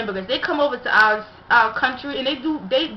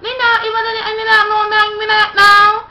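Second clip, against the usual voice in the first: much higher, louder, sing-song mock Chinese; mock Chinese chatter to coworkers, high and loud; mock Chinese gibberish; 11 semitones higher, 9 dB louder than usual. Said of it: "started speaking in Chinese".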